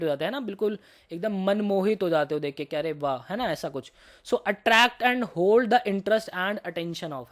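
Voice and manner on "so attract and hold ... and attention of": very slow definition read aloud; slow dictation. Shown as a demonstration. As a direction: draw out each word for notes. Teacher reads definition slowly.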